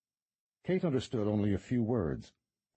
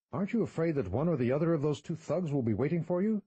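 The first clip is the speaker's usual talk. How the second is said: put-on higher, urgent young woman; Kate's voice; 8 semitones higher, 3 dB louder than usual. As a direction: press him to go after them.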